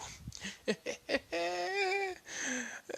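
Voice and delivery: a high, wild mad-scientist laugh; high mad cackle. Acted. Mad scientist cackling at the wreckage. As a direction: cackle like a giddy lunatic.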